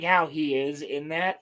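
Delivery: ordinary delivery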